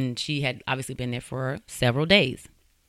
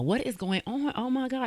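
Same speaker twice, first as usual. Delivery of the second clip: retold yelling; 10 semitones higher, 3 dB quieter than usual. Mom entering her kid's house, retold yelling. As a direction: imitate a mom's cry of alarm, pitched way up. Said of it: "the mom is just yelling out".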